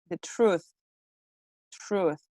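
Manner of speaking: "truth" with oo, softly repeated; correct pronunciation. Shown as a demonstration. Teacher drilling the corrected word, correct pronunciation. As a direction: say "truth" as trooth, pausing between takes. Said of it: "It's truth".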